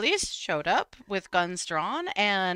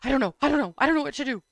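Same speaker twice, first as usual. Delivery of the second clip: higher, louder, repeated helpless plea; imitated sobbing; 5 semitones higher, 6 dB louder, much faster than usual. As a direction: mildly play up a dad in distress. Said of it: "sounded like he was sobbing".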